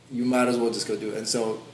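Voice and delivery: usual speaking voice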